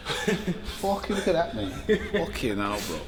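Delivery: laughing through the words, drawn out; real laugh at a ruined haircut; laughing disbelief. Real, not acted. Laughing at his bad haircut.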